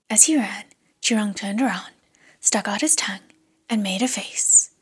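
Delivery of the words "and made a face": ordinary delivery, nothing marked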